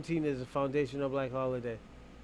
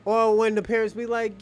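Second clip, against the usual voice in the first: usual speaking voice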